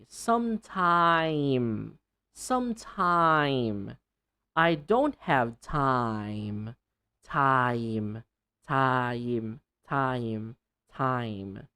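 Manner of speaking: slow "Sometime" with a clear long I; careful long-I drill; modelling long I slowly. Shown as a demonstration. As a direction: draw out "time" as taim. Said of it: "and then faster".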